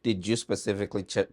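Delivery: ordinary delivery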